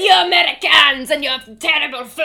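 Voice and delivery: loud, high mock French rant; aggressively French. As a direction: imitate an outraged, over-the-top Frenchman. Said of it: "he's so aggressively French".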